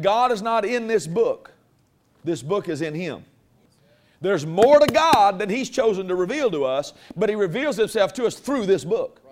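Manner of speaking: preaching. Acted. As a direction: preach it out to the whole room.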